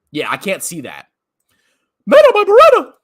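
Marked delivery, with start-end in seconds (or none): 2.0-2.9 much higher, loud sing-song yell; hammed-up shout; silly shout. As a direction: belt the rhyme out for fun. Reading silly chat comment.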